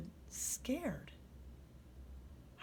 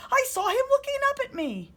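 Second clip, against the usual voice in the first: high, fast and loud; scared cry; 11 dB louder, faster than usual. A narrator's reading. Boy tells mommy what he saw, high fast panic. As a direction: rush it out in fright. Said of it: "he cried".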